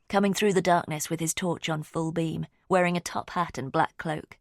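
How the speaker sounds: usual speaking voice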